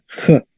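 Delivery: J as a bare rasped "kh"; wrong throaty sound. Shown as a demonstration. Teacher shows what Latin G is not, throaty J. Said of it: "no J".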